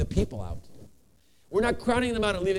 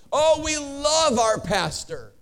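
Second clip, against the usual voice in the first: delighted gush, drawn out and higher; happy gushing; 5 semitones higher, 3 dB louder, much slower than usual. Retold or imitated. Happy congregation to the bishop about their new pastor, gushing. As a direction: gush it slowly, with a big smile. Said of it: "smiling 'cause they're all so happy".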